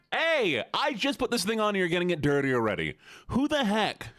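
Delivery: high-pitched indignant protest; indignant outburst. Real, not acted. Streamer objecting to getting new outfit dirty, indignant. Indignant at being dirtied in play.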